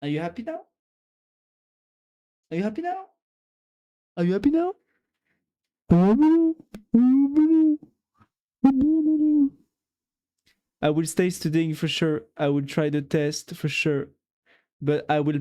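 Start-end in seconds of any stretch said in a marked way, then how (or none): 2.5-9.5 much higher, then louder each time until yelling; mock shout building. Shown as a demonstration. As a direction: ramp a teasing question up to a yell. Repeats question, escalating.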